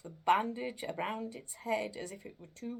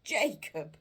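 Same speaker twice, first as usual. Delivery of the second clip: imploring; 3 semitones higher, 7 dB louder than usual. Acted. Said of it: "he said imploringly".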